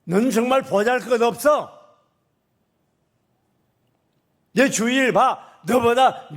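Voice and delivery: loud stern rebuke building to a shout; God rebuking. Acted. God shouts down at the complaining parent, stern and loud. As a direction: scold the complaining parent from above. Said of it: "그럴 때 하나님 뭐라고 하는 줄 알아요".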